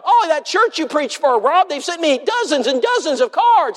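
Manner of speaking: high-pitched, exclaiming in amazement; amazed retelling. Retold or imitated. Man amazed at cards from preacher's church, high excited exclamation. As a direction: act out an astonished man, voice way up.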